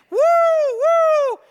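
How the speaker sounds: high, loud whooping "woo"; crowd cheer. Retold or imitated. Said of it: "those crowds of people saying".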